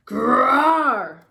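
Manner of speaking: loud, high mock growl; a young lion's loud put-on growl. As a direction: growl at him like a cub lion. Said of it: "said the lion".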